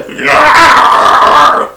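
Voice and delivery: loud breathy dragon roar; a high, loud, voiceless monster yell. Retold or imitated. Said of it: "And then you hear".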